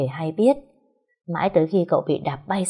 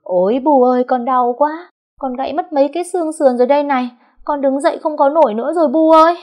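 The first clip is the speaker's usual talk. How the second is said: much higher, louder mock sobbing; hammed-up wailing; 9 semitones higher, 7 dB louder than usual. A narrator's reading. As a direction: wail for mother like a faked injury. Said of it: "Liền cũng khóc ùm lên". (khóc ùm lên giả vờ, kêu gào)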